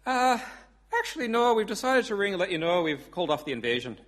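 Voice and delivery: put-on Irish accent. Paddy calling off the invasion, hesitant Irish accent. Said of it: "I do do an Irish accent".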